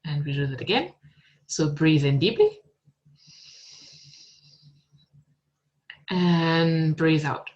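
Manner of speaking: calm, unhurried meditation guide voice; guided meditation. Acted. Teacher guiding students into meditation, calm and unhurried. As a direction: lead them gently inward, with long pauses.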